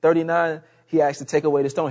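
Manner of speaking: ordinary talk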